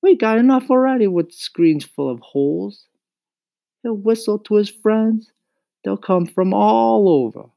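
high firm mother voice; mother refusing bugs in the house, high and firm; firm Mama. Acted. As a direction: lay down the law as Mama.

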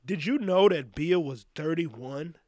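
ordinary talk

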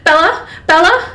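high, loud anxious calling; panicked mother. A narrator's reading. Mother on the phone to Bella, panicked. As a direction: call out in rising alarm. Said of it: "it was the sound of panic".

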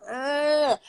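high, disgusted groan; frustrated groan. Retold or imitated. Scorned woman reacting to cheating, groaning. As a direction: let out a fed-up ugh. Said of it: "So I was like".

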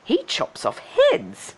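put-on high, slow girlish voice; high-pitched, drawn-out horror; dismayed Cindy. A narrator's reading. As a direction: say it slowly, aghast, in a high voice. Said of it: "she thought".

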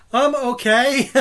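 high, tight "I'm okay" while panicking; strained panicked reply. Retold or imitated. Panicking man answering the actress, strained and high. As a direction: force it out mid panic attack. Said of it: "I'm like".

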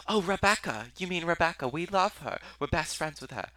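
high, gushing British voice; British accent. Retold or imitated. British porn stars raving about their friend, British accent. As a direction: pitch it way up, British and effusive. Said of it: "that's my British accent".

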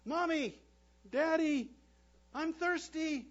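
put-on high, loud little child calling; small child calling from bed; tiny muffled voice. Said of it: "a tiny, muffled voice emanates from the other room".

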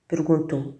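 ordinary delivery, nothing marked

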